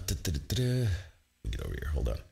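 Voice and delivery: ordinary talk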